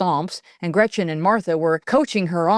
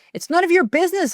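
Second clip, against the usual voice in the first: much higher, fast, flustered defensive; sputtering; 12 semitones higher, faster than usual. A narrator's reading. Cornered girl sputtering back at her bullies. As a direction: blurt it out in panic. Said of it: "I sputtered".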